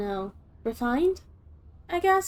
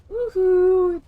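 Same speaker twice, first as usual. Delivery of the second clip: woohoo cheer; 7 semitones higher, 8 dB louder than usual. Pretend. Mocking crazy hand signal.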